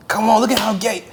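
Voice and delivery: hammed-up drunken egging on; urging shout. Friend at the barbecue urging him to come out, imitated loud and high. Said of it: "And I remember you just like".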